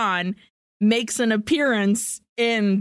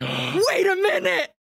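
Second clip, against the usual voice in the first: surprised; 10 semitones higher than usual. Retold or imitated. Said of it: "And I was like".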